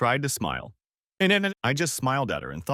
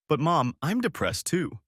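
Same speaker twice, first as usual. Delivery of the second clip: higher, slow mild whine; whiny daughter; 6 semitones higher, slower than usual. A narrator's reading. Daughter pleading with mother, slow and higher. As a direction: plead with mom.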